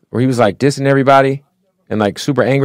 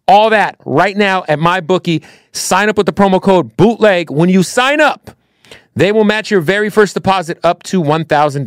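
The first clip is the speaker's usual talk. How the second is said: upbeat host-read sponsor spot, higher than his chat; 5 semitones higher than usual. Acted. Bootleg Kev pitches his sportsbook sponsor to listeners, upbeat ad read. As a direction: sell the betting app to the listeners.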